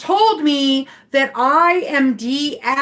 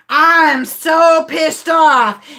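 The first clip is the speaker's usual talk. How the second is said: slow, drawn-out imitated outrage; mock angry passenger; 4 dB louder, much slower than usual. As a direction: stretch it out as an indignant passenger's rant. Retold angry passenger, she's like.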